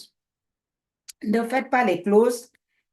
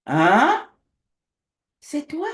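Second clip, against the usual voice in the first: surprised; 7 semitones higher, 5 dB louder, much slower than usual. Retold or imitated. Pastor retells recognizing the woman.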